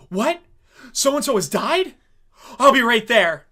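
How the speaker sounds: lightly played cop hearing bad news; mock shocked detective. Detective gets a phone call.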